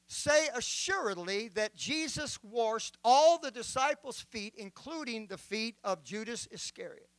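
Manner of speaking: ordinary talk, nothing marked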